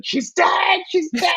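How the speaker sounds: high-pitched melodramatic wail; hammed-up yell. Retelling how the chapter ends with C K yelling, hammed-up yell. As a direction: cry it out in mock hysteria. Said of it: "C K kind of yelling".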